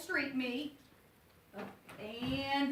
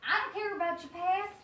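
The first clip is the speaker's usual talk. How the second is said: a lightly raised, brash huckster voice; gruff street vendor; 12 semitones higher, 7 dB louder than usual. Acted. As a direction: sell rats like a pushy street hawker. Abel presses the hiding girl.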